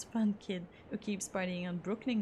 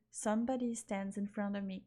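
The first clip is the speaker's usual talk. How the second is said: ordinary talk, nothing marked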